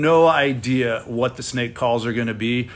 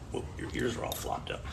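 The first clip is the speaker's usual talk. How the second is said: whispered, tender words to a dog; whispering to a puppy; 17 dB quieter than usual. Real, not acted. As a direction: coo softly at the puppy. Talking to the puppy.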